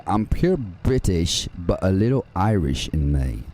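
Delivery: slow put-on British accent. Shown as a demonstration. Gamer shows chat his slow British accent. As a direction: show off a British accent, slowly and proudly. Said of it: "my British accent is pretty good".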